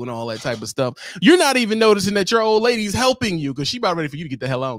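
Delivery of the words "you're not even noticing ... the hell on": fast, louder, higher rant; quick excited roast; fired-up rant. Acted. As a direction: rattle it off, building heat.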